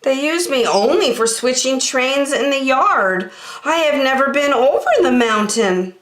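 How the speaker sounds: high, humble engine voice; the blue engine admitting doubts, high; modest little engine. Acted. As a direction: explain shyly.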